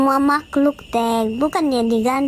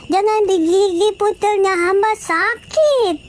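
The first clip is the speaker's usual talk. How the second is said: high, slow pained plea; mock mother wincing while nursing; 6 semitones higher than usual. Mary begs the baby not to bite, high and slow.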